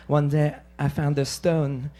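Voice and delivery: a softly mocking French film accent; French film woman. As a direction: murmur it like a French art-film heroine. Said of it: "it's like a French film".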